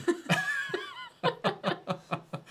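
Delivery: squealing burst of laughter; high laugh. Real, not acted. Laughing at own joke.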